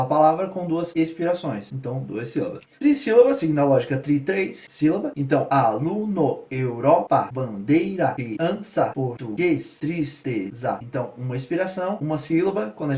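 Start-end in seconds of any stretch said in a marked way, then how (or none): none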